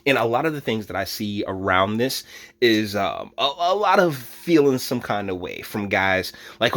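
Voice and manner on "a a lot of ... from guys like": ordinary talk, nothing marked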